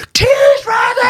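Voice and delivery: high, piercing mock yell across the estate; hammed-up mum hollering a kid home like a siren; Welsh mum's yell. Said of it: "the voice of any Welsh mum was like a fucking air raid siren".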